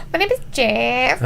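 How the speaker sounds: awkward fake Spanish accent, voice rising at the end; halting comic Spanish accent; mock Spanish accent. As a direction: imitate a badly faked Spanish accent. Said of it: "trying to speak with his Spanish accent".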